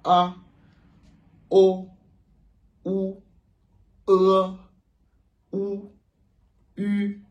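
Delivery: each vowel called high and loud, with pauses; model vowels to repeat. Shown as a demonstration. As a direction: model the vowels one by one for the class. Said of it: "De la manière que je vous les prononce". (voyelles modèles, hautes et fortes)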